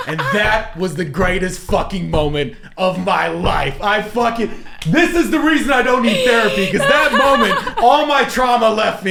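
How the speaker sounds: loud and high, laughing and half shouting; real triumphant laughing. Real, not acted. Telling the host about his revenge moment, laughing triumphant shout. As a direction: roar it out with glee.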